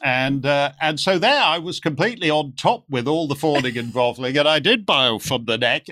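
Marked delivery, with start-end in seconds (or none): none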